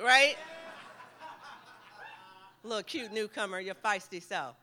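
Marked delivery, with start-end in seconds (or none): 2.6-4.6 softer, affectionate ribbing of a friend; playful teasing aside. Real, not acted. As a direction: tease him gently, half off-mic. Teasing aside to his host Raul.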